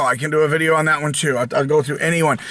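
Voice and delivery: normal talking voice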